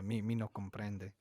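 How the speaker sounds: mock Spanish accent. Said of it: "Didn't ask for Spanish".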